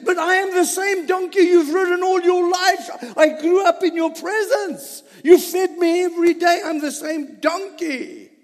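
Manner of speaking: pleading donkey, high and loud, half laughing; talking donkey. Acted. Donkey pleading with its rider in a high laughing voice. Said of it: "and it says".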